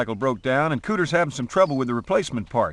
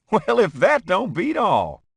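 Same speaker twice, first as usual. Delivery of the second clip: laughing; 6 semitones higher, 3 dB louder, slower than usual. Acted. Laughing at oil news.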